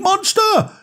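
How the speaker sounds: sudden high startled cry of Monster; mock scream. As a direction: shriek the word as a frightened child. Narrator gasps the monster scream.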